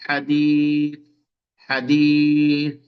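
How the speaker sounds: হাদিস stretched slowly with a firm দ; slow recitation. Shown as a demonstration. Teacher drilling হাদিস slowly for students. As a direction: linger on হাদিস. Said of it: "তারপরে হাদিসুন".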